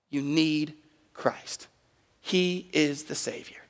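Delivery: very slow, softened preaching; solemn emphasis. Real, not acted. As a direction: drop to a hush and weigh each word. Hushed point after preaching climax.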